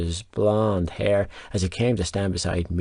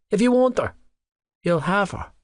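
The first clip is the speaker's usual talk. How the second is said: high sneering echo of his words; mocking mimicry; 9 semitones higher than usual. A narrator's reading. Narrator mocks the rival with a higher voice. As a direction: parrot him back higher, mocking. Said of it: "I barked out a laugh".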